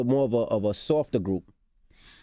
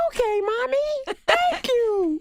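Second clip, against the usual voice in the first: put-on very high, slow little-kid voice; Elmo voice; much slower than usual. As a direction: squeak it out like Elmo. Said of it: "everything he does sounds like Elmo".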